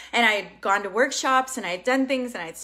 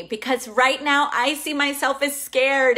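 ordinary talk

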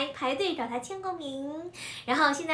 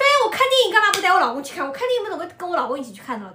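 retort; 6 semitones higher, 7 dB louder, faster than usual. Retold or imitated. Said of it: "我说".

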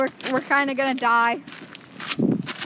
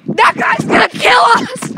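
slow, loud, higher alarmed yell; panicked shout; 7 semitones higher, 10 dB louder, slower than usual. Real, not acted. Player yelling as a gang guy attacks, panicked shout. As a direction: shout the danger out.

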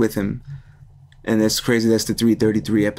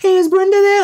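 high, louder sing-song caller; wrong-number caller; 5 dB louder than usual. Retold or imitated. Stranger asking for Brenda, high chirpy caller.

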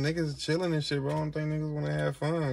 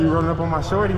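angry; 5 semitones higher, 9 dB louder than usual. Acted. Acted confrontation skit.

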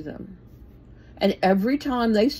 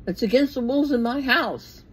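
loud, higher yell to stop the shouting; shouting down someone who is yelling; 5 semitones higher, 4 dB louder than usual. Retold or imitated. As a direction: bark it over the noise. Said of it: "I will yell at someone and say".

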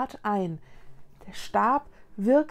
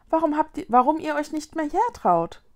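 higher questioning voice; girl inquiring; 6 semitones higher, 4 dB louder than usual. Acted. Mila questions ghosts about their home, inquiring. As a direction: ask in a higher girl's voice.

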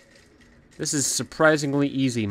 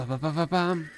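ordinary delivery

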